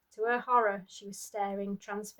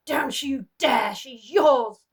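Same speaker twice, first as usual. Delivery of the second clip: desperate scream; 4 semitones higher, 12 dB louder, much slower than usual. Acted. Mother screams at husband.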